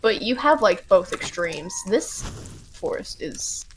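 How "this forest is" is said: quiet. Real, not acted. Reading game text aside.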